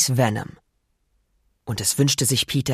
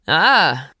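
louder startled "ah"; loud pained cry; 3 semitones higher, 5 dB louder than usual. Acted. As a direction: cry out as you land. Thrown into pizza.

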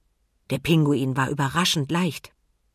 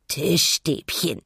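cooing penguin; much slower than usual. A narrator's reading. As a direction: coo it out with a full belly. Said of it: "gurrte er".

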